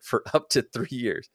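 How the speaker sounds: real laugh breaking into the words. Real, not acted. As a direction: laugh at how extreme it sounds while reading. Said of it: "I'm just laughing 'cause that sounds so extreme".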